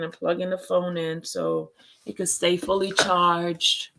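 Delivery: ordinary talk, nothing marked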